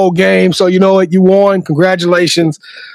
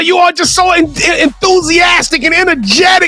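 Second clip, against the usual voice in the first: hype praise; 8 semitones higher than usual. Real, not acted. Host showers Coach Love with praise, high and shouting. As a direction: pump her up with every word.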